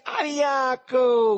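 high, long-drawn loud holler; shout from afar. A narrator's reading. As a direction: holler it from out in the water. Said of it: "gridarono dall'acqua". (grido acuto e prolungato dall'acqua)

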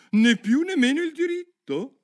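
loud, very high-pitched character voice; Duchess. A narrator's reading. Duchess lecturing Alice, high loud voice. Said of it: "disse la duchessa".

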